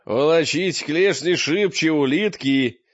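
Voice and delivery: loud, grim hurry-up; gruff urging. A narrator's reading. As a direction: drive the slow ones on loudly. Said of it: "подбодрил Мрак с угрюмым удовлетворением". (громко, грубо подгоняя)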